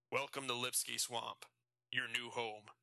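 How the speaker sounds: lightly raspy prison guard; a hint of a rough guard. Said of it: "a guard with a raspy voice said".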